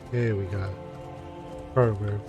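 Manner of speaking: ordinary talk, nothing marked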